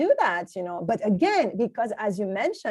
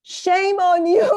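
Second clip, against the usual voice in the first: high, loud, slow mock scold; 9 semitones higher, 8 dB louder, slower than usual. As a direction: drag out the reproach playfully. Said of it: "I'm like".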